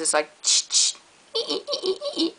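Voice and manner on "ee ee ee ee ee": very high, soft squeaky "ee ee ee"; imitating a squeaky sink. Shown as a demonstration. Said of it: "That was much better".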